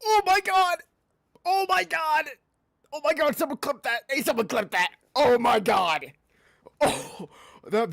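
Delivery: high, loud disbelieving yells; shocked excited shouting. Real, not acted. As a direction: scream it in amazement. Caster stunned by grenade kill.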